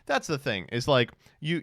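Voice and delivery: normal talking voice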